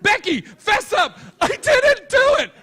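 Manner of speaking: very high, loud, desperate denial; Becky pleads she didn't do it, shrilly; shrill denying girl. Retold or imitated. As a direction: protest your innocence hysterically. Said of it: "She goes, she goes".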